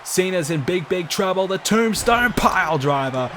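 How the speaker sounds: climbing hype into the big move; excited. Acted. Finisher about to land.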